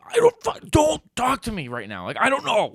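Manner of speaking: high, loud yell, acting out panic; re-enacted frantic outburst; exasperated shouting. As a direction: snap back at full volume. Acts out snapping at girlfriend.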